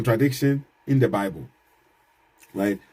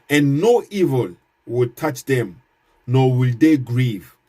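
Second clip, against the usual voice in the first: slow verse reading; 4 dB louder, slower than usual. Shown as a demonstration. Reads Quran verse aloud.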